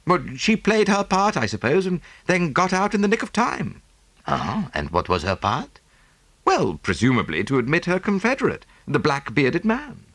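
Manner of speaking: Hastings voice. Acted. Hastings objecting to Poirot, lightly voiced. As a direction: Hastings brightly cuts in.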